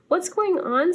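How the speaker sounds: high startled question; surprised Opus. Acted. Opus lifted by the ducks, high surprised cry. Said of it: "said Opus".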